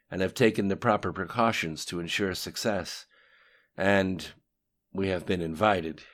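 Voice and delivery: brisk, projected briefing to a superior; reporting underling. A narrator's reading. Lim Shun reports on resources to the voice, lightly acted briefing.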